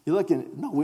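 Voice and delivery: normal talking voice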